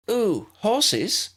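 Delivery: very high excited squeak. A narrator's reading. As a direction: squeal it like a thrilled child. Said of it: "Lydia squeaked".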